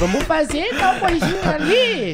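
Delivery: high, slow, laughing mock voice of Georgette; imitating Georgette. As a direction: squeak it out like a scheming friend. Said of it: "Ela vai fazer assim".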